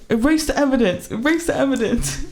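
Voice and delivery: high-pitched mock urgency, amused; mock urgent self-command. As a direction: play up a panicked cover-up, half laughing. Retold panicked self-command after falling.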